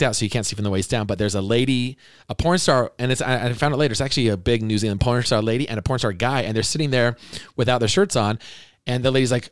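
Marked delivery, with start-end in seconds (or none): none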